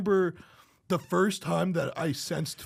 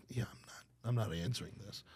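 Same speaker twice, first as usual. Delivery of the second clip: whispered aside; 7 semitones lower, 12 dB quieter, faster than usual. Retold or imitated. Ignoring a call in a meeting.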